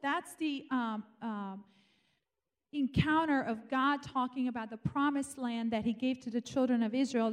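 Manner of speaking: ordinary talk, nothing marked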